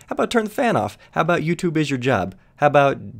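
high incredulous rhetorical question; exasperated. Real, not acted. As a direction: ask it sky-high in disbelief.

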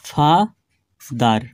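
ফা-দার very slow, drawn-out ফা, long pause, then দার; split into two syllables. Shown as a demonstration. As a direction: say ফা-দার as two separate chunks. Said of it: "দুইবারে উচ্চারণ হলো".

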